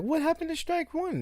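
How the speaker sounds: high mocking whiny voice; mock confused friend; imitating her. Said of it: "she'll be like".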